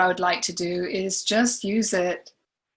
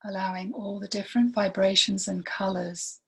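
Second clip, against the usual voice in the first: guided meditation; 3 dB quieter than usual. Real, not acted. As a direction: lead gently through it, leaving long silences between phrases.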